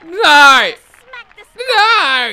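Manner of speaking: high loud cries of no; watching the ball fly, shouting no; anguished shouts. Real, not acted. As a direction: yell in dismay. Watching a bad play.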